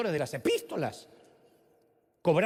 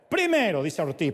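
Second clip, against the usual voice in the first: ordinary delivery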